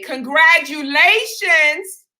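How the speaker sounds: high, slow, sing-song congratulations. Retold or imitated. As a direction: gush it out like an overly sweet clinic worker.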